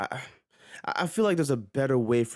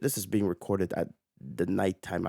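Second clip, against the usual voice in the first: ordinary talk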